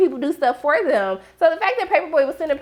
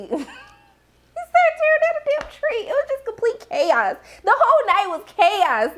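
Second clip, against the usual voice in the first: much higher, slower, laughing through the words; overwhelmed with laughter at the chaos; squeaky laughing retelling; laughing disbelief; 8 semitones higher than usual. Real, not acted.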